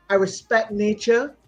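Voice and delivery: ordinary talk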